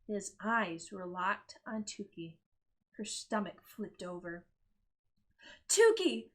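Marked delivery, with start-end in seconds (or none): 5.7-6.3 high, loud scolding call; sharp call. Acted. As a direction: snap her name. Mother snaps at Tukey.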